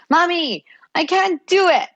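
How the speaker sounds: put-on high, whiny little kid who can't do it; high child's wail of frustration. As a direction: play the frustrated toddler, high-pitched. Child frustrated over tying shoe.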